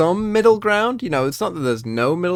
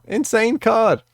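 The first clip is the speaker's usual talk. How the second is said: ordinary talk, nothing marked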